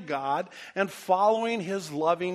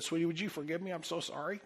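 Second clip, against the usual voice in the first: softer, tender plea for forgiveness; 9 dB quieter than usual. Retold or imitated. Husband asking his wife to forgive him, tender. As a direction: ask her gently to forgive you.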